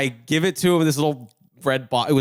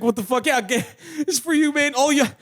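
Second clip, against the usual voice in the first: mock big brother; 11 semitones higher than usual. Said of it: "he was just like".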